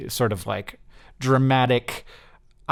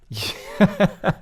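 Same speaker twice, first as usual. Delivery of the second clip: amused laugh; 7 semitones higher than usual. Real, not acted. Laughing at answers.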